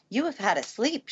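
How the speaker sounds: a hint of a stern housekeeper; Mrs. Medlock. Housekeeper waking the sleeping girl, lightly brisk. Medlock wakes Mary on train.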